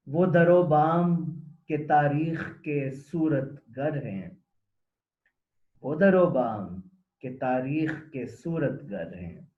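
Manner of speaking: slow, measured Urdu poem recital, each phrase starting strong and falling away; poem recitation. Acted. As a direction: recite verse with long pauses between phrases. (ठहर-ठहर कर नज़्म पढ़ने का अंदाज़)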